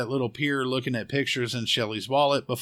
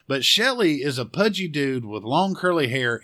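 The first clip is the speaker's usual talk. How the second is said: ordinary talk, nothing marked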